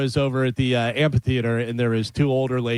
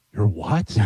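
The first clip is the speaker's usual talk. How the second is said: lower-pitched, lightly acted disbelief; confused older ladies; 4 semitones lower than usual. Older ladies to a cashless vendor, mildly acted confusion. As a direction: imitate a baffled customer. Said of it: "So confused".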